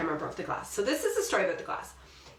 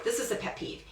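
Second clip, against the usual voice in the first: ordinary delivery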